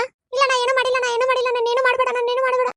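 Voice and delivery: high, fast panicked begging; frantic frightened denial; terrified pleading. Acted. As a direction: beg the ghost for mercy. Terrified nurse begging the ghost.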